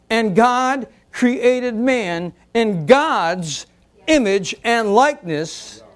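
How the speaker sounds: slow, deliberate reading of scripture; measured pulpit reading. Shown as a demonstration. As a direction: read it out word by word, very slowly. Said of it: "x0.5 slow reading".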